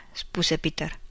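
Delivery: ordinary delivery, nothing marked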